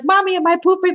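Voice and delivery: put-on high, childlike worried question; anxious asking mom. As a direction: ask like a scared little girl. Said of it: "I remember being like".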